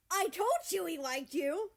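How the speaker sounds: high, very loud slow crow of triumph. A narrator's reading. Canada on the phone to America, loud triumphant crow. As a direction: gloat at being right. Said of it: "Canada crows".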